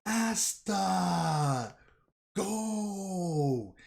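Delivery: high yelled battle cry calling Asta; screaming a name. Retold or imitated. Captain screams at Asta to go, high yelled cry. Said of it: "And he screams".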